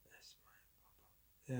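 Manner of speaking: breathy whispered "that's fine"; whispered aside. Real, not acted. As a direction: whisper it to yourself. Muttered aside to himself.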